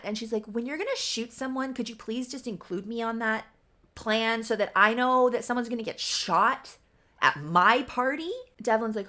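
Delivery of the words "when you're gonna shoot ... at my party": loud, sarcastic mock outrage; so mad. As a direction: build from pointed request to loud indignation. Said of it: "she's so mad".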